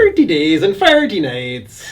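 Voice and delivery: Thirty for forty in an Irish brogue; Irish accent. Retold or imitated. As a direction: say it the Irish way, lightly. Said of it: "Do the forty days and forty nights".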